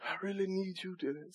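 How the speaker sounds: very soft, prim little prayer; mock a dainty, half-hearted prayer; mocking a polite little prayer; cute prayer. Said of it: "you get cute".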